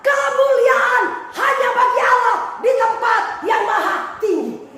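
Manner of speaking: shouted high and slow, like a triumphant declaration; proud proclamation. Shown as a demonstration. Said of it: "kalau saya berdoa".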